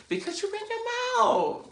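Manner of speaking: very high, squeaky mock outrage; exasperated blame. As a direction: squeal the accusation. Acted outrage at the friend's gossip.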